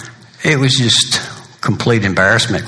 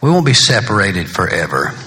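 normal talking voice

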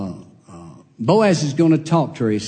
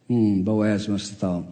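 ordinary talk

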